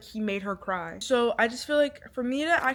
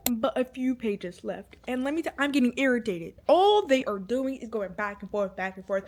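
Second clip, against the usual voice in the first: ordinary delivery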